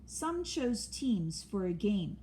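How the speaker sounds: ordinary delivery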